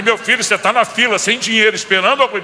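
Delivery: loud, high, exasperated rebuke to his son; scolding shout. Retold or imitated. Father scolding his son in the line, loud and exasperated. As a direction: shout it at him in disbelief.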